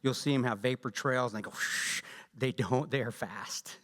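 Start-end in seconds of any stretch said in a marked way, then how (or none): 1.5-2.0 a soft breathed rocket whoosh, no voice; breathy whoosh. Retold or imitated. Mimicking movie RPGs, voiceless whoosh. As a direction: imitate a movie rocket trail with breath only. Movie RPG vapor trail sound.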